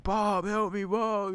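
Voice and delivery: put-on high, loud child's scream; screaming for help. Stung kid calling for his dad, high loud scream. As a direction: yell the name in a panic. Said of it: "I'm like".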